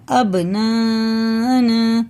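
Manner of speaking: very slow अबनाना with the b bounced hard; slow bounced b demo; qalqala on b. Shown as a demonstration. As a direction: pop the b in अबनाना, lips meeting and parting. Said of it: "बा में भी कलकला है".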